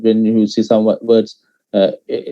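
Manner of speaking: ordinary talk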